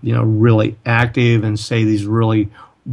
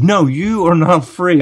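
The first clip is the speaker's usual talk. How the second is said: higher, emphatic mock contradiction; imitated emphatic insistence; 7 semitones higher than usual. Man contradicting the speaker's claim of freedom, imitated emphatically. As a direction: mimic an opponent doubling down.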